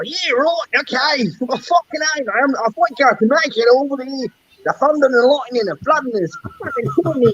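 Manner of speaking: high, fast Aussie bloke voice; hammed-up Australian, mate; mock Australian accent. Streamer playing an Australian driver for a chatter, mock Australian accent. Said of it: "I gotta get my Australian fix".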